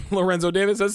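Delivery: usual speaking voice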